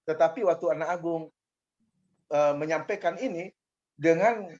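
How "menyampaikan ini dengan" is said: ordinary delivery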